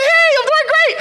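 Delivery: high, loud sing-song phone reply; mock cheerful reply. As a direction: play along brightly with the caller. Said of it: "I say".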